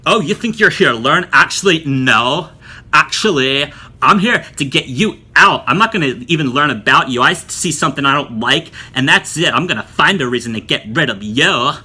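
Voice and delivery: loud, hammed-up hostile instructor; mock confrontational. Instructor threatening to get a student out, mock hostile. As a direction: imitate a teacher out to get the student. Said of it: "just confrontational with me and angry".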